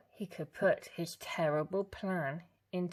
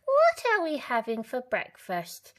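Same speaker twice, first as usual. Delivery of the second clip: granny; 7 semitones higher, 8 dB louder than usual. A narrator's reading.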